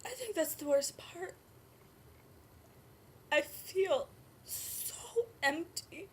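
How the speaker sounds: raw voice in the wake of sobbing; tearful grief. Acted. Birth mother admits how empty she feels, tearful. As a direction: squeeze the words out between sobs. Grieving after breakdown.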